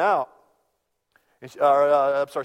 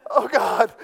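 high, loud panic; mock childish panic; panicked kid; 9 semitones higher, 8 dB louder, slower than usual. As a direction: squeal it like a scared child who missed the rapture. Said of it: "I was like".

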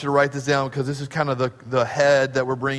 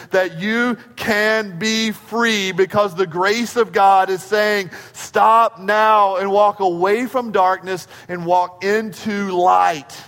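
louder, slow, building preaching; rising preached call; 3 semitones higher, 4 dB louder, slower than usual. Real, not acted. Preacher urging the congregation to walk into light, louder and slow. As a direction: drive the call home slowly. Sermon climax, urgent call.

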